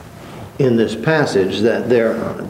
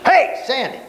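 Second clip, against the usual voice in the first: much higher, calling across the room; teacher demoing a yell at a student; mock yelling; 13 semitones higher than usual. Shown as a demonstration. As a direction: call out to Sandy across the room. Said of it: "is me yelling at you".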